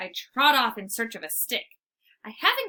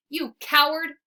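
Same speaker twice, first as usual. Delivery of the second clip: angry bark; 4 semitones higher, 5 dB louder than usual. Acted. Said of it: "I bark".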